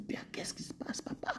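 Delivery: hushed prayer to father; whispered plea. Retold or imitated. Believer asking God in confusion, whispered. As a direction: murmur it low like a secret prayer. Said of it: "On se dit".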